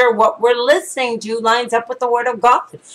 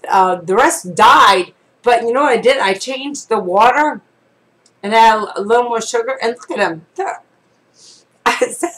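ordinary talk, nothing marked

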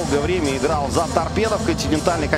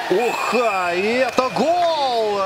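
slow, higher goal shout; excited goal call; 8 semitones higher, much slower than usual. Acted.

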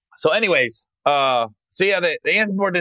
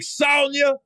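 punchline comeback; 11 semitones higher than usual. Retold or imitated. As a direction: land the zinger big for the crowd.

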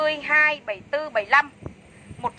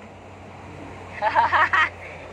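airy unvoiced laugh, real and amused; breathy laugh; 3 semitones higher than usual. Real, not acted. Seller laughing at husband's joke on live, breathy laugh.

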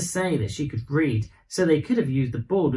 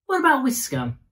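put-on high, puzzled child question; confused child; 10 semitones higher than usual. A narrator's reading. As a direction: ask it high and baffled. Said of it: "Vera was confused".

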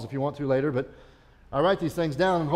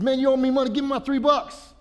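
high, loud furious demand; mock-furious servant shouting for three bucks; angry shout; 11 semitones higher, 6 dB louder than usual. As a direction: choke the guy and yell for the money. Servant choking debtor, acted.

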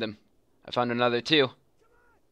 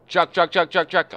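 loud, higher repeated warning; urgent alarm; 7 semitones higher, 7 dB louder than usual. Real, not acted. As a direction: rattle it off as a warning. Hearing an enemy truck.

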